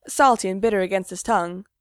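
ordinary delivery

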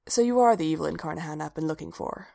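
usual speaking voice

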